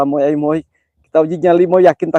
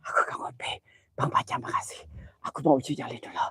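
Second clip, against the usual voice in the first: hushed scared whisper; frightened whisper in the dark; 15 dB quieter than usual. Real, not acted. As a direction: barely breathe the words out. Ghost-hunting stream, scared whisper.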